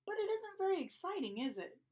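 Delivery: mildly grumpy, high and quick at first; lightly acted storybook grumble; grumbling. A narrator's reading. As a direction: grumble like a bored kid, falling away. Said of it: "Gilbert grumbled".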